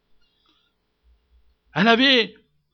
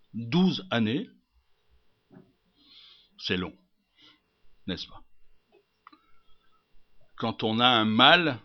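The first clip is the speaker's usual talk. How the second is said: soft, low pulpit reflection with long pauses; hushed grave preaching; 4 semitones lower, 10 dB quieter than usual. Acted. Preacher confiding to the congregation about long suffering, hushed. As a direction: let each phrase sink in, low and soft.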